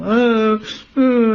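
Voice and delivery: high, loud hammed-up wailing; tantrum wail. Disguised cousin throws a tantrum on the floor, loud mock wailing. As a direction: wail like a child in a temper tantrum. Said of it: "She's crying, making a big scene".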